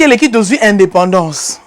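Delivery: usual speaking voice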